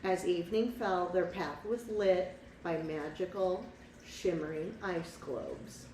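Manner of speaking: put-on lively read-aloud for a child; picture-book narrator voice; storybook narration. A narrator's reading.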